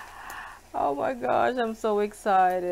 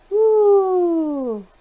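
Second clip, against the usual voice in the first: a high, loud woo; whooping at the sizzling beef; excited whoop; 11 semitones higher, 12 dB louder than usual. Real, not acted. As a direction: cheer like a fan at a good sizzle.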